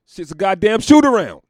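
slow, loud, high disbelief; outraged yell. Retold or imitated. Watching the All-Star game, loud outraged yell. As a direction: bellow it at the TV in disgust. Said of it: "I was like".

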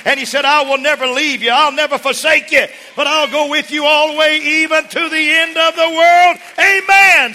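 loud, high-pitched preacher's proclamation; full-force preaching. Real, not acted. Preacher delivering God's promise to the congregation, full-force preaching. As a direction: preach it at a shout, building to Amen.

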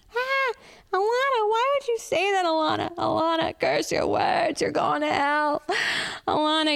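mock scolding voice. Comic calling herself out in a high scolding voice. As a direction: scold yourself like a pious aunt.

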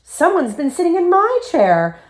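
very high, indignant mother bear; astonished storybook mother, high-voiced; Mommy Bear exclaiming. A narrator's reading. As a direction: exclaim it with pitch way up. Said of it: "Mommy Bear exclaimed".

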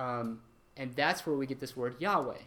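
usual speaking voice

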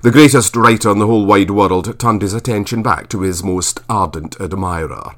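ordinary delivery

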